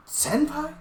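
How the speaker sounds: a sharp, high shocked echo of the name. Acted. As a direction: blurt the name in sheer disbelief. Startled at the name.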